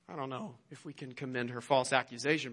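usual speaking voice

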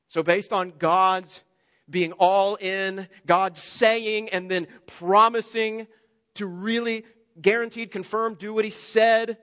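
slow, loud, rising preaching; preaching at full force. Real, not acted. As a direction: hammer each phrase home. Sermon climax building.

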